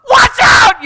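high, very loud warning yell; alarmed shout. Acted. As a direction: scream it to stop a child at the curb. Parent shouts as child nears traffic.

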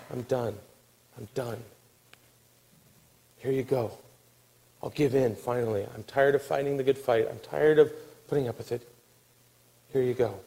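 soft, low, weary resignation; drained acted sigh of defeat; worn-out surrender. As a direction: give up quietly, as if too tired to persevere. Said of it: "When you're so tired".

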